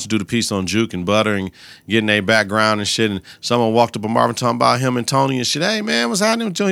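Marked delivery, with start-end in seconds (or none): 5.6-6.7 much higher, fast nosy voice; pry at him quickly; nosy stranger. Retold or imitated. Stranger asking Marvin about Tony, high and fast.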